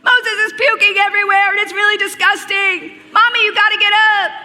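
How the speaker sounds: high, loud imitation of a panicking kid; hammed-up, high-pitched kid in alarm; freaking out. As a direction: mimic a frantic child yelling for mommy. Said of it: "Noah starts freaking out".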